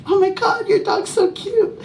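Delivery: high, gushing mock coo over a cute dog; gushing fan. Passerby gushing over her dog, high mock coo. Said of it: "You're like".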